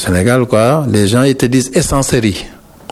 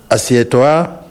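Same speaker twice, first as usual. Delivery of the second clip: ordinary talk, nothing marked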